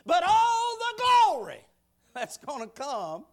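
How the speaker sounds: ordinary delivery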